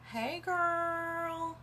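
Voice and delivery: high, drawn-out mock-gushing greeting; gushy salesy greeting. Consultant mocking spammy sales messages to friends, fake gushy. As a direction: stretch it out in a high, fake-chummy singsong. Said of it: "we're not gonna do the".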